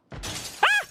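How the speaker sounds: sudden high shriek of alarm; startled shriek. Real, not acted. Gamer startled by a breaking window, high shriek.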